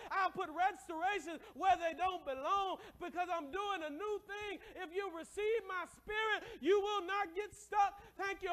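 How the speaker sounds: loud, high, slow shouted preaching; full-force preaching. Acted. Pastor thunders God's promises at the church, shouting. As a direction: preach it with everything you've got.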